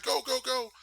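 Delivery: high, urgent panicky urging; mock panic. Imitating a wrestler escaping zombies in a van, mock panic. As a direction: beg the driver in pretend panic.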